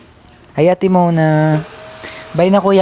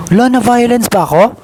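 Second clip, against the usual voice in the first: indignant; 7 semitones higher, 5 dB louder than usual. Real, not acted. Protesting being called violent.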